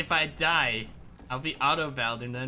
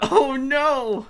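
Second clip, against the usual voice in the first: high, loud panicked "oh no"; alarmed cry; 10 semitones higher, 7 dB louder than usual. Real, not acted. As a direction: yelp as the enemy team closes in. Team swarming the tower.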